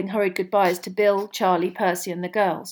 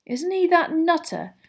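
George teasing; 9 semitones higher than usual. A narrator's reading. George voiced.

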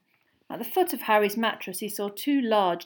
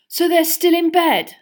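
slow, louder, higher grumble; loud, slow, grumpy protest; 10 semitones higher, 13 dB louder than usual. A narrator's reading. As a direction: complain slowly like a teen dragged out of bed. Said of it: "said Fred grumpily".

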